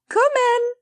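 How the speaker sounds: high, loud call to the door. Acted. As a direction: call the visitor in. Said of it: "cried Patty".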